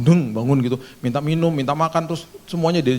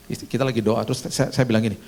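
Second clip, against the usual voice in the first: ordinary talk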